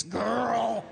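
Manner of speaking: put-on high, campy drawn-out Girl. Shown as a demonstration. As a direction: camp it up like at Pride.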